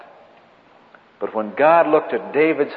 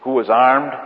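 ordinary talk

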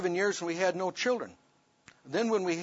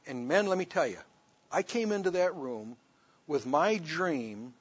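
usual speaking voice

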